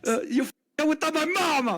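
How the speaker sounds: high, hammed-up Italian accent; mock Italian accent. Mocking Luigi's note in a cartoon Italian accent. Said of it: "continues the Italian bit".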